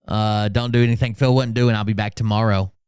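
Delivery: ordinary talk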